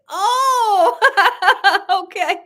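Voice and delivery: bursts into a loud laugh; loud surprised laugh. Real, not acted. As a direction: crack up at the comment. Laughing at viewer comment.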